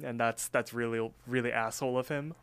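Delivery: ordinary talk, nothing marked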